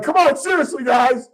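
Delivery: high-pitched retold plea to stop; mock-panicked, exasperated appeal; panicked pleading. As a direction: plead with joking friends, voice shooting up. Pleading with friends rocking the gondola.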